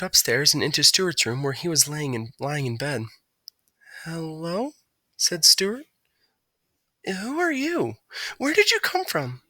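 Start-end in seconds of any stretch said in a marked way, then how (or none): 4.0-9.4 a higher, lightly voiced Stuart; gently curious; curious little mouse. A narrator's reading. Stuart meeting the bird, curious, in a higher voice.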